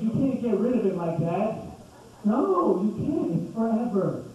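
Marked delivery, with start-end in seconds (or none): none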